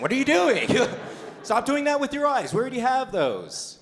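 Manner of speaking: high, loud mock outrage; indignant scolding. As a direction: scold dad in exasperated disbelief. Mock scolding dad over his act.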